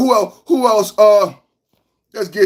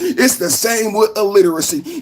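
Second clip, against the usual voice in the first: ordinary talk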